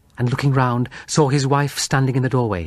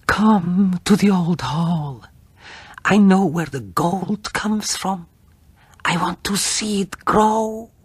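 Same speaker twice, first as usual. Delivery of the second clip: eerie feverish wife; 6 semitones higher than usual. Acted. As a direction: lure him in a haunted, dreamy voice. Feverish wife lures husband.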